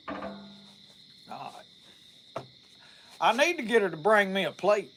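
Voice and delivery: ordinary talk, nothing marked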